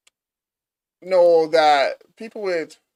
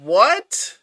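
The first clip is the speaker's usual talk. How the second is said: higher, louder, can't believe it; stunned disbelief; 6 semitones higher, 4 dB louder, slower than usual. Real, not acted. Streamer floored by the billionaire's claim, shocked. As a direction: bro said WHAT, floored.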